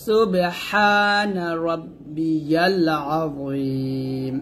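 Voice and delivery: ordinary talk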